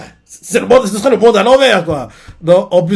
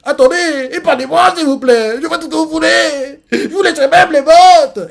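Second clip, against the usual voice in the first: much higher, wailing plea for mercy; hammed-up sobbing clown; tearful begging; 11 semitones higher, 4 dB louder than usual. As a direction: beg like a crying coward, voice cracking at the end. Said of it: "en larmes, il fait".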